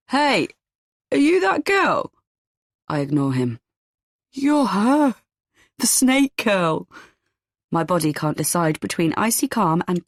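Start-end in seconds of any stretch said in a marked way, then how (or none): 0.1-2.1 put-on slurred drunk man. A narrator's reading. Drunk stranger accosts a woman at the bar, slurred. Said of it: "his words are slurred". 4.3-6.9 put-on raised, slow drunken slur; slurred drunk man. A narrator's reading. Drunk stranger identifies the woman at the bar, slurred. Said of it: "his words are slurred".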